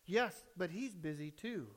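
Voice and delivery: soft, low, secretive answer; reply quietly as a little boy; hushed small voice; small child's voice. Acted. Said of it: "silent voice said".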